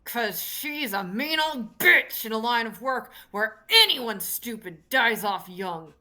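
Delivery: loud, forceful gruff granny; gruff old lady. Acted. Old woman boasting of her deadly line of work, loud and gruff. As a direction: belt it out as a tough old woman. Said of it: "doing the gruff old lady voice".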